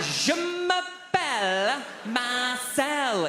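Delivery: drawn out very slowly and high; stubborn slow answer. Retold or imitated. Student answering the professor, drawn out very slowly. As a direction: spell it out slowly like to a child. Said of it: "I went".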